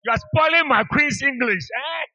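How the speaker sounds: loud, high comic indignation; mock outrage. Preacher scolding congregation over their English, mock outrage. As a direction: protest grandly, pitched high and loud.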